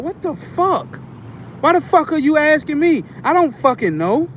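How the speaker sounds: snapping exasperated. Retold or imitated. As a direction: snap at the clueless asker.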